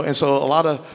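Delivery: ordinary talk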